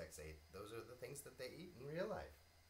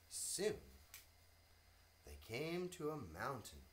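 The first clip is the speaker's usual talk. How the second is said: plain reading voice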